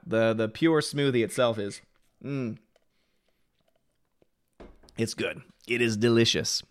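soft, hushed ASMR tasting. Real, not acted. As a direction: savor it in a soft ASMR hush. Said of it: "Sorry to turn that into A S M R".

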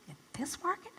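whispered incredulous question; doubtful whisper. Retold or imitated. Best friend doubting the house sale, whispered.

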